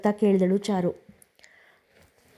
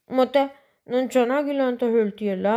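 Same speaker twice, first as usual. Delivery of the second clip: mild whimpering cry; 4 semitones higher than usual. A narrator's reading. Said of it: "ಕುಸು ಕುಸು ಅಳುತ್ತಾ ಕೇಳಿದ್ದಳು".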